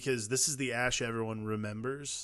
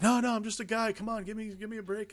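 higher, whiny no-no excuse; reluctant Ash; 10 semitones higher than usual. Retold or imitated. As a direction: beg off like a guy who wants out.

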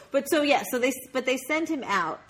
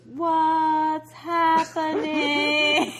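very slow, drawn-out, higher and louder; slow motion; 6 semitones higher, 4 dB louder, much slower than usual. Retold or imitated. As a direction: stretch every syllable like slowed film. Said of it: "he goes".

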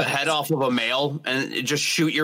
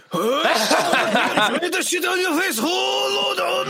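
high-pitched shouted mock Japanese accent; 11 semitones higher, slower than usual. Shown as a demonstration. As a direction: yell it in a hammed-up Japanese accent. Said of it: "Japanese. What else you got?".

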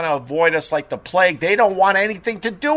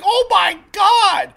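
much higher, louder, slow drawn-out cry; shocked shout; 4 dB louder, much slower than usual. Retold or imitated. Host reliving the pigeon hitting the windshield, shouting in shock. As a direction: yell it as the bird explodes. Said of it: "And I'm like".

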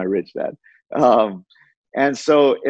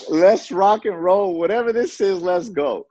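high, drawn-out rallying cry; acted gung-ho eagerness; 9 semitones higher, slower than usual. Newcomer jumping at whatever this is, high and gung-ho. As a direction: pitch it way up, all in. Retold eager leap at the opportunity.

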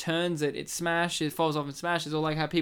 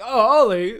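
loud delighted Ollie; 3 semitones higher, 9 dB louder than usual. Retold or imitated. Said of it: "they're like".

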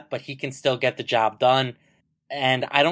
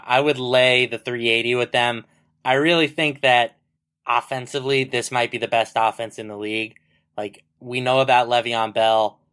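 ordinary talk

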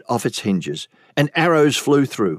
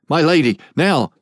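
louder, higher, pressing command; guard urging the queen to run, urgently; urgent call; 4 semitones higher, 3 dB louder than usual. Acted. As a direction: get her moving right now.

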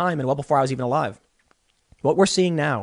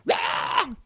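hammed-up roar, pitched high; quick mock roar; mock rioter roar; 9 semitones higher, 6 dB quieter than usual. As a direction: roar like a rioter, half in jest. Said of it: "they're going".

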